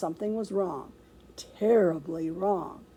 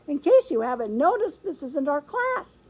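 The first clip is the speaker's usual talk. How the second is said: high, raised little protest; squeaking hamster; 6 semitones higher, 3 dB louder than usual. A narrator's reading. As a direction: squeak a complaint to teacher. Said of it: "I squeaked".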